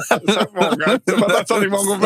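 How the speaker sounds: loud laughing protest. Real, not acted. Failing the hand choreography, laughing loudly at himself. Fumbling the dance move.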